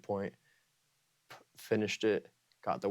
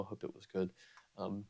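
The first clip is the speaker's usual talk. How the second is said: ordinary delivery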